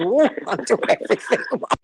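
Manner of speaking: high, startled mock alarm; playful horrified realization; mock dismay. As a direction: gasp it out as if caught doing it all wrong. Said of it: "We all say".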